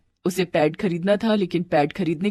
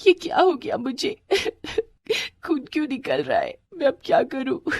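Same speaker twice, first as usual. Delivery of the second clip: acted girlish weeping; crying; 8 semitones higher than usual. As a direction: sob the questions out helplessly. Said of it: "bathroom में बैठकर रोने लगी".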